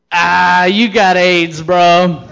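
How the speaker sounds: loud, careless bro voice; dumb buddy yell. Retold or imitated. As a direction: blurt it out like a clueless buddy. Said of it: "And he goes".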